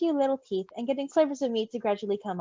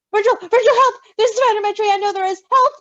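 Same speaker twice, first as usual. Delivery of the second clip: higher, louder frantic calls; panicked cry for help; 10 semitones higher, 8 dB louder than usual. Acted. Patton screaming to Virgil in terror about a spider.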